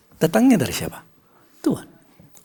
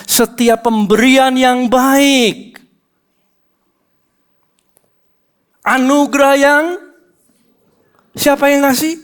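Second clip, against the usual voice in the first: high, slow, ringing verse reading; emphatic scripture reading; 9 semitones higher, 4 dB louder, slower than usual. Acted. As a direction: declaim the verse to the church.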